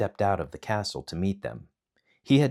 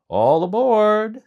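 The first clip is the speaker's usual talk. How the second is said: 1 calling out; 14 semitones higher, 11 dB louder, slower than usual. Acted. Hero calls to crew.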